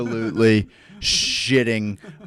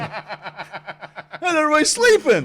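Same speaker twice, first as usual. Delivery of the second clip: high-pitched manic question; wired disbelief; 13 semitones higher, 5 dB louder than usual. Retold or imitated. Wired crew among sleeping people, high manic disbelief. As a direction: squeal it, hammed-up and baffled.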